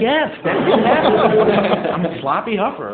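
high, loud, slow mock confession; sarcastic laughing admission. Son admitting to mom, slow, high and laughing. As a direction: drag it out, high and loud, barely holding in laughter. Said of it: "I'm like".

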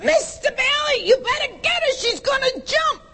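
very high shrill yell from down in the yard; neighbor screaming. Retold or imitated. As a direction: shout up a warning to the husband, way up high. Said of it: "she started to screaming".

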